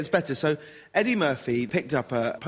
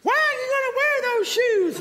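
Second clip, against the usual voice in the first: much higher mock nagging wife; high woman voice. Guest acts out a Murphy character, high mock wife voice.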